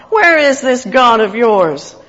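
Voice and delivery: loud, slow scoff. Acted. Psalm reader voices the scoffing enemies, taunting. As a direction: draw out the taunt loudly. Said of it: "They scoff".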